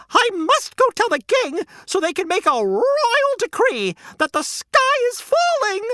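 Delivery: high, panicked, squawky hen voice; a flustered hen sounding the alarm; frantic hen. Acted. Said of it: "Henny clucked".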